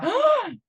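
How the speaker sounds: sharp high gasp of wonder; delighted gasp. Pretend. Coach gasping in delight at self-discovery. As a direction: gasp as if struck by insight.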